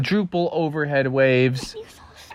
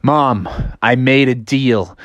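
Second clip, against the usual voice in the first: low, resigned confession; reluctant admission; 7 semitones lower than usual. Acted. Bear confesses his deal to mom, low reluctant voice. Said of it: "And he's like".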